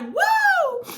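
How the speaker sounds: very high hype whoop; high woo. Retold or imitated. Friend singing along on phone, high whoop. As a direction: cut loose like the song. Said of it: "He's like".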